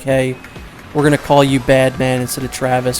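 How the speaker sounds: ordinary talk, nothing marked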